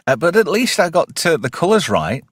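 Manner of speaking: usual speaking voice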